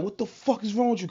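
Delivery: high-pitched retold outburst at the fake cops; mock furious yell, reliving being tackled; retold angry yell. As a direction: throw it back at them like you did then. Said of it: "I'm like".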